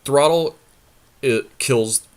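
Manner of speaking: normal talking voice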